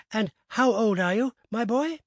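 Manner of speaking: lightly voiced eager host; eager old gentleman. A narrator's reading. Abney asks again.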